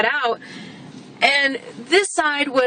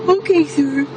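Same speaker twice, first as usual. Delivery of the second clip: mock meek; 3 semitones higher than usual. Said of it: "expecting me to go".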